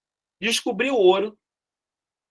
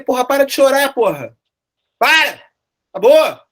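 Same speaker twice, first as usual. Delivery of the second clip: higher, louder scolding yell; retold yell; 6 semitones higher, 7 dB louder than usual. Teacher acting out yelling at the weeping king, loud. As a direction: snap the crying king out of it. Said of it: "eu falei".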